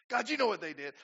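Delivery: mock whiny tattle to God; tattling prayer. Preacher mocking his own tattling prayer, mock whine. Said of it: "I'm tattling on them".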